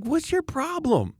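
very high, slow, drawn-out whine; exasperated mockery. Reacting to one of these people, high mock exasperation. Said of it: "it's one of these people".

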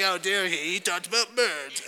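hammed-up imitation of an offended bird lover; mock upset. Preacher mimics upset bird lovers in the congregation, mock upset. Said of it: "that was my upset voice".

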